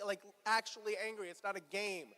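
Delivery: normal talking voice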